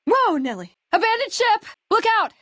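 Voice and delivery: high, urgent yelling; alarmed shouts. Acted. Companion warning the player in a firefight, high alarmed shouts. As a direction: cry out in alarm. Panicked combat call-outs.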